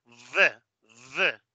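"V" buzzed by itself, upper teeth on lip; vibrating V. Shown as a demonstration. Teacher demonstrating tooth on lip, vibrating V. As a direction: make each "V" vibrate before it releases. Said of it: "lo haces que vibre".

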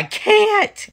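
very high, slow whiny squeak; squeaky plea. Real, not acted. To the viewers about eating duck, a squeaky whine. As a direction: make it a tiny helpless whine. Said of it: "can't eat ducks, pleading".

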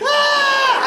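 sudden very high yelp; jazz hand scream. Shown as a demonstration. Comedian demonstrating his startled scream again. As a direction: shriek like someone just jumped you. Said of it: "followed by this noise".

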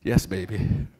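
low, soft and gentle; tender yes baby. Retold or imitated. Husband answering his wife, low and tender. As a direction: answer your spouse sweetly. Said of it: "real love says".

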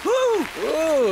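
loud high swing grunt; a loud exertion huff; effort grunt. Acted. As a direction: grunt as you hit the ball.

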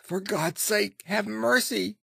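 high-pitched begging; frantic pleading, pitched far up; desperate plea. A narrator's reading. Cornered man begs for mercy.

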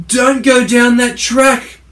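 loud, high, slow shout of warning; crying out. Acted. A koala calling out the warning, high and loud. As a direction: koala yelling down a warning from the trees. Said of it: "he cried".

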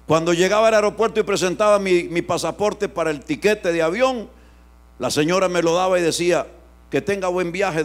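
loud, driving pulpit delivery; preaching with force. Acted. Preacher telling the church about the airport, loud. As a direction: build it for the congregation.

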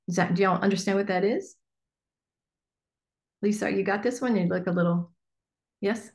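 ordinary talk

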